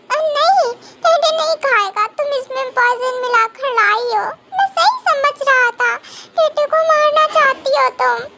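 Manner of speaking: lightly acted tearful child accusing; childlike tearful refusal. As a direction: protest like a crying small child. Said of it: "रोना बंद करो".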